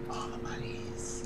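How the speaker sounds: very soft, slow whisper; whispered echo. Pretend. Listener echoing the money aside, slow whisper. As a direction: draw it out in a hushed whisper.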